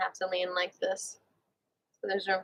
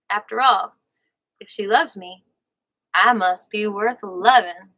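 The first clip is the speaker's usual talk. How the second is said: Southern accent; 8 dB louder than usual. Real, not acted. Host slips into channeling the book's author, light Southern accent. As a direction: read it out with a hint of the American South. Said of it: "I'm talking in a Southern accent".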